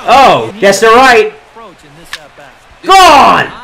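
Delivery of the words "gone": high happy shout; excited call. Real, not acted. Gamer calling his own homer. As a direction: call it gone.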